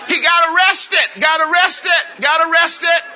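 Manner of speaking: high, slow opening, shouted repeats; loud preacher's shout. Real, not acted. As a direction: call it out hard over the crowd. Calling out hardship over congregation.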